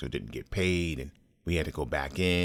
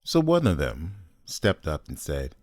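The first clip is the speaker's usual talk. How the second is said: ordinary delivery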